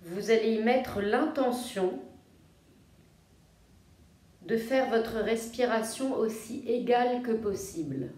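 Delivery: soft, low-key yoga nidra voice with long pauses; guided meditation. Real, not acted. Yoga teacher guiding listeners to feel their breathing, calm meditation voice. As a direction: guide them gently into rest, phrase by phrase.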